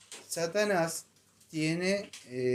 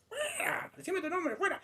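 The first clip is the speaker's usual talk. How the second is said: very high, softer mock exorcism cries; imitando al exorcista; 5 dB quieter than usual. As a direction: squeal out commands, mimicking the exorcist. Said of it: "pero él lo decía así". (órdenes de exorcista en imitación aguda)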